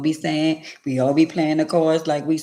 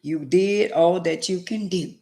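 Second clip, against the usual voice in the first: usual speaking voice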